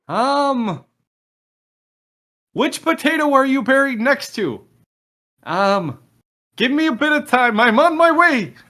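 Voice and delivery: high, loud put-on voice; frantic messenger. Game master relaying a rescuer's reply to the buried party, high shouted character voice. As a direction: call out the reply at high pitch.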